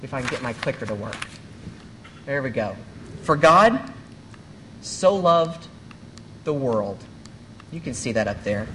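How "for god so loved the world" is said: each phrase set apart with pauses; slow it down so every word can land; read slower. Shown as a demonstration. Pastor rereading the verse for the congregation, slow and deliberate. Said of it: "and this time I'm gonna read it slower".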